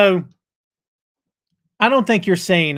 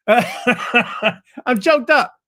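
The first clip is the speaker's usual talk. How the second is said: laughing, pitched higher; choked up; 5 semitones higher, much slower than usual. Real, not acted. Host interrupts his own show intro, choked up and laughing. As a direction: laugh through the words. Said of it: "and I'm choked up".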